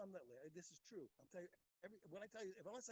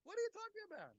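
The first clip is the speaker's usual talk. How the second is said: high, loud and incredulous; shocked, raised cry of disbelief; indignant outburst; 11 semitones higher, 7 dB louder than usual. Real, not acted. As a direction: fling the question back in disbelief. Outraged retort to a listener.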